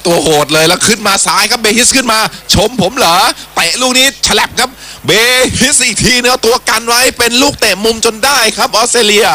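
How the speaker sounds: lively match call, voice climbing; excited commentary. Real, not acted. Live attack down the left.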